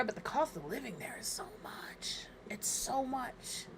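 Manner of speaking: breathy whisper, no voice; whispered complaint. Real, not acted. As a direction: whisper it like a small secret. Whispered complaint about cost.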